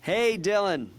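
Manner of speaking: booming upbeat phone opener with a smile; forced cheerful greeting. Shown as a demonstration. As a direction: put on big energy despite feeling terrible. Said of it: "even though I feel terrible, I'm like".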